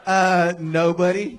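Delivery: ordinary talk, nothing marked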